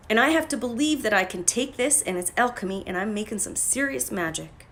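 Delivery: usual speaking voice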